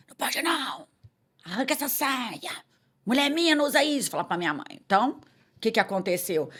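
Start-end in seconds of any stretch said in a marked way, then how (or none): 0.1-4.1 higher-pitched imitation of a bossy Mineiro dad; stern father. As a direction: lay down the law like an authoritarian father. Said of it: "meu pai, mineiro, assim, sempre foi aquele". (imitando pai mineiro autoritário)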